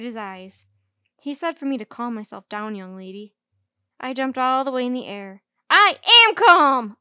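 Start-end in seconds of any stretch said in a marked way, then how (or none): 5.7-7.0 very loud, slow furious holler. A narrator's reading. Junie B hollering back at her teacher, very loud. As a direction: yell that you're calm. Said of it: "I hollered back".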